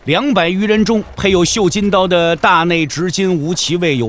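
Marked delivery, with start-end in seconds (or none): none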